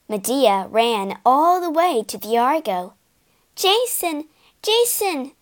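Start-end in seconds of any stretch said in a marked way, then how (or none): 3.6-5.3 high calls across to Jason; calling out. A narrator's reading. Medea calling out to Jason in warning. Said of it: "she called".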